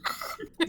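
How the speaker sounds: unvoiced wheezing laugh; breathless laugh. Real, not acted. Still cracking up.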